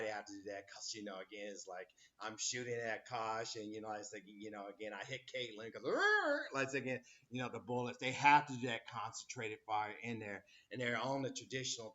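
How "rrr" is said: a high, rolling rrr like a musket going off; mock gunfire noise. Teacher imitates a musket volley for the class with a high rrr. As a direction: growl out a silly gun sound.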